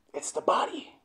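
hushed, unvoiced whisper; soft breath-only emphatic whisper; whispered repeat. Real, not acted. Same answer repeated in whisper.

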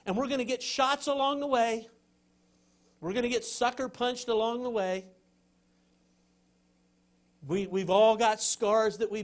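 loud, high, driving sermon lines; fervent preaching. Acted. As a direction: push each line hard like a rallying call. Preacher rising again to full force.

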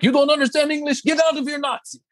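high-pitched mock yell of an outraged pastor; imitating yelling Polish pastor. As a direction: impersonate an irate pastor ordering intruders out. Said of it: "this guy in this Polish accent".

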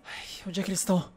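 fast, hushed whisper. Real, not acted. Gamer hiding from enemies asks where they went, fast whisper. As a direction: whisper so the enemies won't hear.

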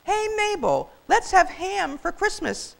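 high, loud, slow call; calling out to wife. Acted. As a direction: holler it to Mabel. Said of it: "He called out to his waiting wife".

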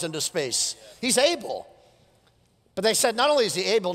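fired-up pulpit preaching, rising and pressing; preaching at full force. Acted. Pastor rousing his congregation, full preaching voice. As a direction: preach it out to the whole church.

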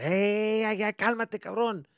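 loud, high Spanish scolding; upset dad. Retold or imitated. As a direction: rein in the hyper kid. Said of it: "my dad would just get upset".

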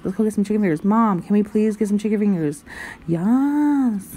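usual speaking voice

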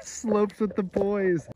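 high, slow laughing repeat of the title; laughing echo. Pretend. Echoing his friend's goofy show title, laughing. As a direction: savour the silly show name through a laugh.

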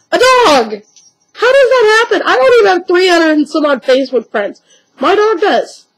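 can't believe a dog beats you; amazed. Real, not acted.

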